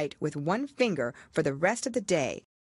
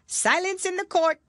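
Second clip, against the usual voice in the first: cried out; 5 dB louder than usual. A narrator's reading. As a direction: shout for order, high and loud.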